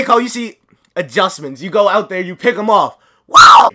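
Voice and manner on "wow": very high loud wow; amazed shout. Real, not acted. Gamer amazed at a play, shouting. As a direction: explode in amazement.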